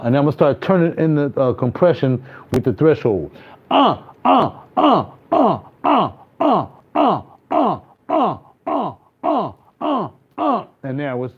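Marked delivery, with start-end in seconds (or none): 3.7-10.7 compressed ad-libs. Shown as a demonstration. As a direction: shout uh while compression tightens. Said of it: "now it's compressed".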